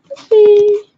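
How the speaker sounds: high, loud call of a name. Real, not acted. Owner shouts to bring her dog back, calling out. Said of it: "If we shout him".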